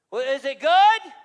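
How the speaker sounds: a king loudly demanding news; anxious king. Acted. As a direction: press him for the answer. King demanding answer from Daniel.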